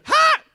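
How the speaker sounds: sharp very high loud laugh bark; burst of laughter. Real, not acted. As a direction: let out one explosive cackle.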